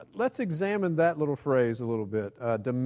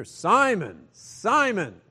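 higher, louder double call of Simon; urgent call; 8 semitones higher, 9 dB louder, much slower than usual. Shown as a demonstration. Jesus wakes Simon with an urgent, louder call. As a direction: call out to wake him. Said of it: "It's waking him up".

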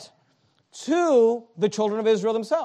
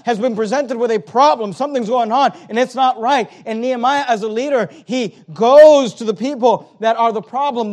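ordinary talk, nothing marked

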